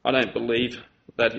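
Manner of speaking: a hint of a sulking kid, quick and whispered; sulky child. Child refusing the lolly, lightly voiced and sulky. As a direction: murmur it, head down, arms folded. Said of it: "goes like this".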